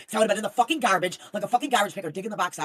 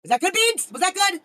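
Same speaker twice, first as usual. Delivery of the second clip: put-on loud, higher, childlike eagerness; eager squeal; 10 semitones higher, 8 dB louder than usual. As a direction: beg for approval excitedly. Eager question to Beans.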